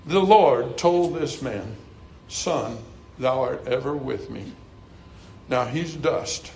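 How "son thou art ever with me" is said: soft, low fatherly words; tender Lord's voice. Acted. The Lord speaking tenderly to his son, softly. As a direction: hush it like a father comforting a son.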